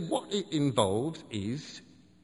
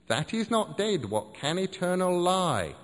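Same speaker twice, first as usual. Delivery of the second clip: slow, higher grand quotation; poetic recital; 6 semitones higher than usual. Acted. Seth quoting the poet, slow and grand. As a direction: declaim the verse slowly. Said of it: "as the poet has it".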